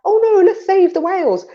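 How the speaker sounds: high, hammed-up pleading; a caricature of a worried liberal; mock high do-gooder. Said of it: "somebody's like".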